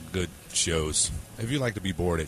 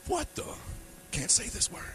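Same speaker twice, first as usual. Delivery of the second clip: surprised; 6 dB quieter than usual. Retold or imitated. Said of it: "people were in line like".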